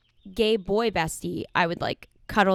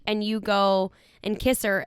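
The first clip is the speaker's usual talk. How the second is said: normal talking voice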